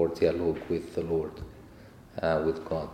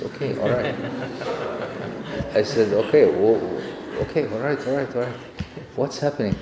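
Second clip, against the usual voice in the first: startled; 4 semitones higher, 4 dB louder than usual. Retold or imitated.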